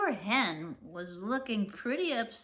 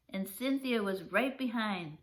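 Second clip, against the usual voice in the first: plain reading voice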